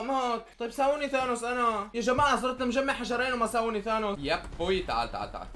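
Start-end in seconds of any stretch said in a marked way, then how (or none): none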